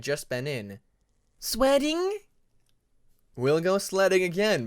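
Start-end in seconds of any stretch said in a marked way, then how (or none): 1.4-2.2 put-on very high, loud little child squeal; excited dragonette. Bobcat the baby dragon squealing excitedly to his father. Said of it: "the excited voice of a dragonette". 3.4-4.7 cheerful father. Acted. Winter answers his son.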